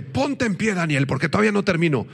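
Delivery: high, hurried order from an angel; urgent angelic command. Acted. Angel tells Daniel to stand up, urgent command.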